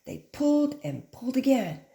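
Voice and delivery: plain reading voice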